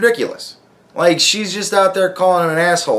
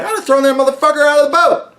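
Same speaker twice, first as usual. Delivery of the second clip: mock angry rant; 9 semitones higher, faster than usual. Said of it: "And I was like".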